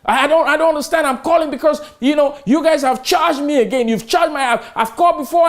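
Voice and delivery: high, fast flustered customer; imitating a flustered irate customer on the phone; irate caller. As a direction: pitch it way up like a confused angry caller. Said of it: "this is what you call irate".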